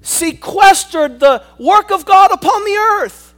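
full-force preaching. Acted. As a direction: preach it hard to the congregation.

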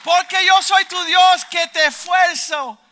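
slow, high, booming shout; full-force scripture cry; prophetic proclamation. Retold or imitated. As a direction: thunder each phrase out, drawn long.

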